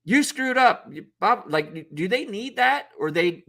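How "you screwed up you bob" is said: mock coach yell, much higher; hammed-up sideline yell at a kid; imitating a yelling coach. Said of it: "Do they need the coach to go".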